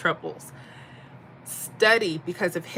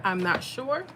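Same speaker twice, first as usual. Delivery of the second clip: ordinary talk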